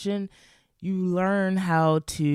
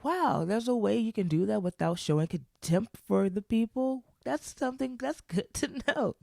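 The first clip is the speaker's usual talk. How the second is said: lightly voiced friend; 7 semitones higher than usual.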